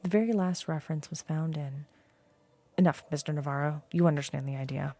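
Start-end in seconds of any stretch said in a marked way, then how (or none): 2.8-4.9 lower, faster, stern questioning voice; a stern old man cutting to the point; blind old scholar. Acted. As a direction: press the visitor for answers. Borges speaks to Navarro.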